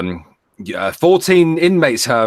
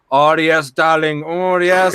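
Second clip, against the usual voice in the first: higher, hammed-up Indian accent; Indian tech support accent; 4 semitones higher, 5 dB louder, slower than usual. Tech support agent sweet-talking a caller, hammed-up Indian accent. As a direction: purr it in a mock Indian accent. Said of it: "fucking Indian bloody tech support accent".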